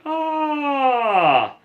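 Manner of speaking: high ah sliding down; yawn-sigh falling down the scale; yawning glide. Shown as a demonstration. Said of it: "you go from up to down".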